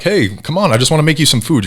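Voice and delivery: mock-welcoming invite to eat; friendly local. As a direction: Louisiana neighbor offering food, lightly voiced friendly host. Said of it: "if people are like".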